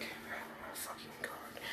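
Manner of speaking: breathy, barely voiced groan of disbelief; whispered, fed-up oh my god; exasperated whisper. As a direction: whisper it like you've run out of patience. Deku fed up with classmates.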